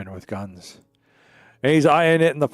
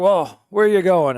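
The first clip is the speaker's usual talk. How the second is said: higher, louder hearty question; friendly farmer call; 7 semitones higher, 7 dB louder than usual. Acted. Farmer asking the hitchhiker where he's going, hearty. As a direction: call out cheerfully from the cart.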